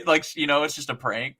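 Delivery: ordinary talk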